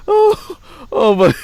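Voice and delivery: high squealing laughter, gasping oh my; cracking up, can't stop laughing; helpless laughter. Real, not acted. Vlogger cracking up at a Brady ad. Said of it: "I'm laughing so hard".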